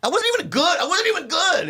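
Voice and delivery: high, whiny self-mockery; whining about his own bombed set; mock wail. Real, not acted. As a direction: whine it high, twice over. Exaggerated self-deprecation, repeated.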